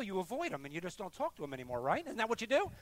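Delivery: ordinary talk, nothing marked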